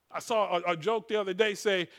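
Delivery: usual speaking voice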